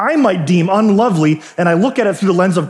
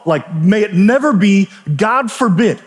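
ordinary talk, nothing marked

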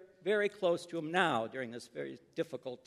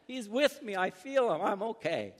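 high, raised cry, easing at the end; excited shout; 6 semitones higher than usual. Acted. Sick boy calling out during the pastor's prayer, excited. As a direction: call it out high with joyful relief, short of a full yell. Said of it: "Johnny shouted".

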